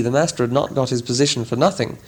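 usual speaking voice

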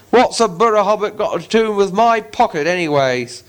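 troll growling in suspicion; suspicious troll. Acted. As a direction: William demanding answers of Bilbo. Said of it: "said William".

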